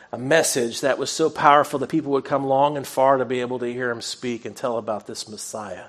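ordinary delivery

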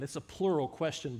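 usual speaking voice

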